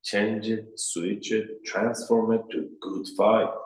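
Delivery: ordinary delivery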